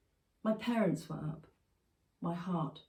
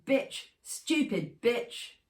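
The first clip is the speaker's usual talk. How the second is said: furious dad shouting insults, slowing on the last word; angry shout; 5 semitones higher, 4 dB louder, much slower than usual. Acted. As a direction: spit it out in rage. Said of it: "My dad was shouting".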